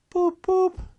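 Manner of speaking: high, loud wailing horn call; very high, loud imitated horn wail; wailing car horn. A narrator's reading. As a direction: wail it like a distant motor horn. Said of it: "wailed like an uneasy animal in pain".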